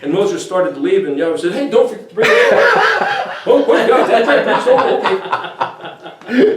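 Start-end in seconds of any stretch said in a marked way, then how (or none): 1.5-3.5 much higher, louder, laughing voice for God; teasing God. Retold or imitated. God voiced teasing Moses, retold. 3.5-6.6 mock surprise. Moses voiced reacting, retold.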